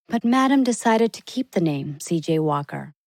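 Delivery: ordinary delivery, nothing marked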